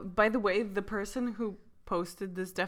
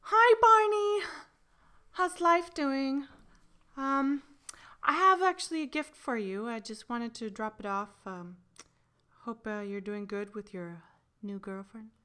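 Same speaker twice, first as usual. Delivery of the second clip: acted ex-girlfriend; 3 semitones higher, 3 dB louder, slower than usual. Ex visits Barney with a gift, acted awkward ex-girlfriend. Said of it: "I want you to have, like, the voices".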